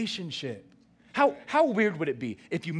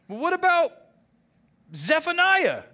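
much higher and louder, quick and eager; eager disciples; 12 semitones higher, 6 dB louder than usual. Retold or imitated. Disciples quizzing Jesus, high and eager. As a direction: keep pushing with the next question. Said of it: "And they go".

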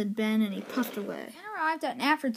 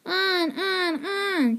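higher egging-on chant; trucks yelling; 7 semitones higher, much slower than usual. Acted. Trucks yelling at Ben to go faster, high mischievous chant. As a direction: push him on like mischievous trucks. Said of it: "yelled the trucks".

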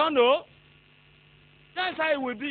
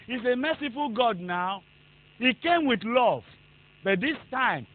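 normal talking voice

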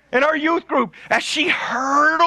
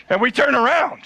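ordinary talk

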